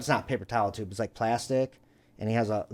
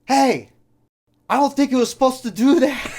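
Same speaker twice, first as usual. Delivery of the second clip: high, loud neighbor calling over; imitated yelling; 10 semitones higher, 5 dB louder than usual. Neighbor yelling to his friend after the misfire, imitated holler. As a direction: holler it across the yard as the neighbor. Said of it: "he's yelling, you know?".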